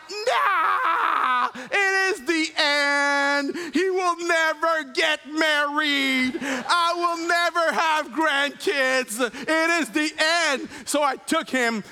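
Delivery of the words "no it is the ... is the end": drawn-out melodramatic wail of despair; acted freak-out. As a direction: wail like a father whose world is ending, hammed-up. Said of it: "So I begin to freak out".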